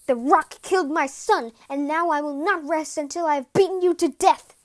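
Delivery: loud, furious tirade; berating. A narrator's reading. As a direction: rage at your son's killer, hitting the words hard. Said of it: "berated the impostor".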